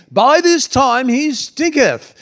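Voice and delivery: high, slow, hammed-up English accent. Shown as a demonstration. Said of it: "read that with an English accent".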